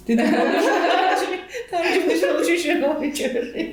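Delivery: high, laughing through the words. Real, not acted. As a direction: laugh as you say it.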